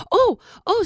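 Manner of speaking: high startled squeak; startled little gasp of words; surprised groundhog. Acted.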